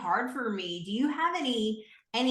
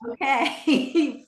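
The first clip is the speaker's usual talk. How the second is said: laughing, high mock okay; mom's grudging okay, laughed and high; 8 semitones higher, 7 dB louder than usual. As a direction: squeeze the okay out through a laugh. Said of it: "about five years before my mom was like".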